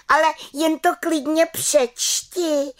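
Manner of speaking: high, drawn-out little witch's cry; defiant cry. A narrator's reading. Little witch daring her aunt to read, crying out. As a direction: challenge her in a high, slow call. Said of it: "vykřikla malá čarodějnice".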